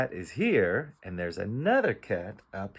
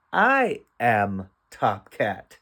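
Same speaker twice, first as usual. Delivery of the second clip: "I am top cat" stretched word by word, firm "I"; very slow, emphatic read-aloud; slow beginner reading; 5 dB louder, much slower than usual. Shown as a demonstration. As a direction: read each word separately and clearly. Teacher reads decoded sentence slowly.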